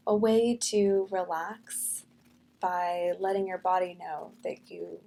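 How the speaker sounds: soft, unhurried meditation voice; guided meditation. Real, not acted. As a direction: ease them gently into calm breathing. Leads a breathing meditation.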